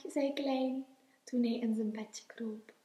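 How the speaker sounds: ordinary delivery, nothing marked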